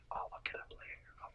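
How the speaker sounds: hushed whisper, kept off mic; whispered aside. Real, not acted. Telling the co-host privately he'll check later, whispering. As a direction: mutter it under your breath as an aside.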